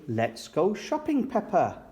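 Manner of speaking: usual speaking voice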